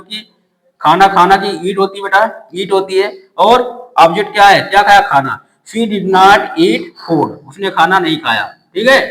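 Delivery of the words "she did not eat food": slowly, word by word; slow dictation. Shown as a demonstration. Teacher reading the finished sentence to students, slowly. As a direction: read the full answer for copying. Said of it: "building the sentence".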